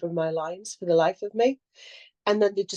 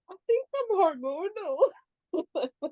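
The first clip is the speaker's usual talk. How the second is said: mock teary; 12 semitones higher, much slower than usual. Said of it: "Still crying".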